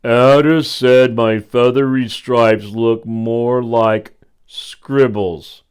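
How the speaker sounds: slow, loud owl moan. Acted. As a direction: moan out the complaint slowly. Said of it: "Owl moaned".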